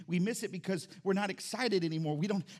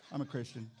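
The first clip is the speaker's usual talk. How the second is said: deadpan mumble; 7 semitones lower, 5 dB quieter than usual. Retold or imitated. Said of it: "they just stare at you".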